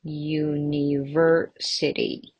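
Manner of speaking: slow, segmented teacher's model; sounded out slowly. Shown as a demonstration. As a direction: stretch the word out syllable by syllable. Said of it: "Again, five syllables".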